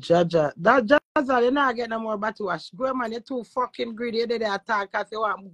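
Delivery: ordinary delivery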